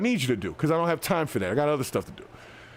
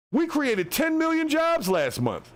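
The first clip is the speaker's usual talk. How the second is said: higher, slow, hammed-up press-briefing voice; mock official announcement; 11 semitones higher, slower than usual. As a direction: parody a proud government spokesman. Mocking an official jobs announcement.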